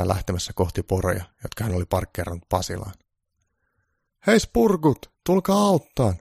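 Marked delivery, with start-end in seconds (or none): 4.3-6.1 hyvin korkea, kova huuto. A narrator's reading. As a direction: huuda töykeästi apua. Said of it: "joku huusi". (töykeä mies huutaa korkealla ja kovaa)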